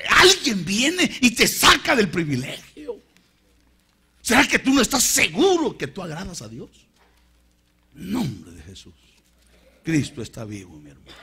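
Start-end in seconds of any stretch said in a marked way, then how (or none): 0.1-6.8 high, loud challenge from the pulpit; impassioned preaching. Real, not acted. Preacher questioning believers' assurance, impassioned and loud. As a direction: preach it out at full force.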